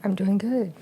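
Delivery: whispery, airy true voice. Shown as a demonstration. Wife answers co-host showing off her true voice, whispery. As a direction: half-whisper it, as if on an exhale. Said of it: "trying to use my true voice".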